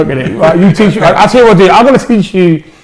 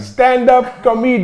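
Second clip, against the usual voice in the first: higher, slower attempt at a Greek "Stand-up comedian"; imitating a Greek accent; 8 semitones higher, slower than usual. Shown as a demonstration. Comedian imitates the actor's Greek accent. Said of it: "say it with a Greek accent".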